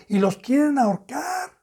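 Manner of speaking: ordinary delivery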